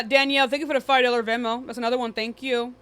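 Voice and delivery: ordinary talk, nothing marked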